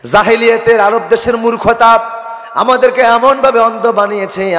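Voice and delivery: high, ringing lament of a haunted father; anguished preaching. Acted. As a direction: lift the father's confession into a sermon chant. (ওয়াজের সুরে বাবার উঁচু গলার বিলাপ)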